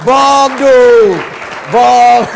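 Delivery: loud, high drawn-out cry of a name; amused exclamation. Real, not acted. Host reacting to the guest's joke, loud amused exclamation. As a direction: call it out long and loud in amused disbelief.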